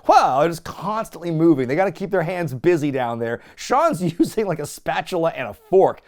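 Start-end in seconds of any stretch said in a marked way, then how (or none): 0.1-0.4 loud, high mocking wow; exaggerated impressed exclamation. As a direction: ham up an awed wow. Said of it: "They're just going".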